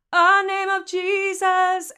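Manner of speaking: slow, loud sung "A name of Jesus"; A vowel onset. Shown as a demonstration. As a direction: start on an "uh" with almost no T H. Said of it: "put a teeny little T H".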